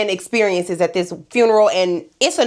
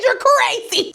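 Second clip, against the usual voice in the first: high, disbelieving jab; incredulous; 13 semitones higher, slower than usual. Pretend. Host telling the risen corpse off, high and incredulous. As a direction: call the dead man crazy.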